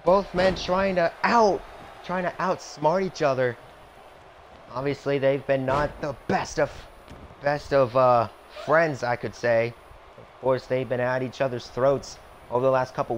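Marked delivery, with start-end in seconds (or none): 0.0-9.7 lively play-by-play announcer; wrestling commentator. Acted. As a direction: call the match like a TV announcer. Plays wrestling announcer over game.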